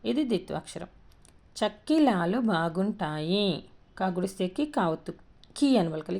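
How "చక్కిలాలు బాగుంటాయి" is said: drawn-out practice sentence for learners; slow reading aloud. Shown as a demonstration. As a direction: read it slowly, stretching each syllable. Teacher reads practice sentence aloud.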